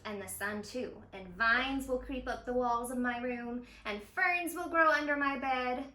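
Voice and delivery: gentle read-aloud narration with voiced dialogue; storybook reading. A narrator's reading. Zoo educator reading a story to young viewers, gentle storytelling. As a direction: read it like a picture book for kids. Reads picture book aloud.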